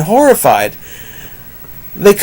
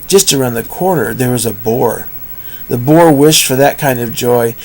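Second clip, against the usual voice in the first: plain reading voice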